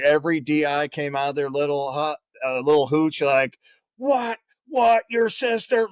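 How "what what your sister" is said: much higher, disbelieving drill instructors; mock incredulous. Drill instructors swarming the homesick recruit, mock incredulous.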